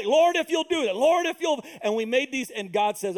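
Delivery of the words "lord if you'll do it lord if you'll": pleading prayer. Retold or imitated. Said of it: "we made all".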